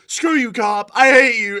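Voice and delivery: high, loud mock fury; hammed-up defiance; suspect yelling. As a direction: snap at the cop. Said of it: "everyone's like".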